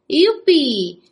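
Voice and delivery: loud, high whoop; whooping yell. Acted. Joe Desperado galloping alongside the train, whooping yell. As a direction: yell it like a cowboy swinging a lasso. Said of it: "zařval".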